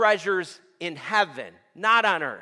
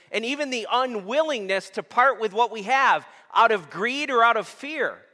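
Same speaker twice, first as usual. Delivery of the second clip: normal talking voice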